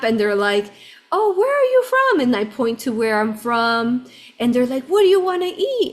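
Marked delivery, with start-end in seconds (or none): 1.1-2.2 very high, louder child imitation; adorable little kids. Little boys with a map questioning a tourist, high-pitched kid voice. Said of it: "they're just adorable". 4.8-5.9 high-pitched child imitation; adorable little kids. Little boys reading cards to practice English, high kid voice. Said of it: "they have to read out".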